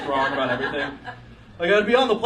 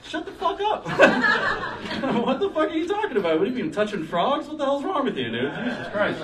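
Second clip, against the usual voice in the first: high, scoffing disbelief; mock outraged barfly; 12 semitones higher than usual. Bar crowd mocking the sailor's merman story, high scoffing voice.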